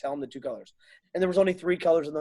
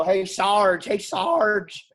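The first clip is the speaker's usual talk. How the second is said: higher, louder, pleading for help; frantic call to sarge; 5 semitones higher, 5 dB louder, slower than usual. Retold or imitated. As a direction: call the sergeant in a mock panic. Retold bomb disarming, calling sarge.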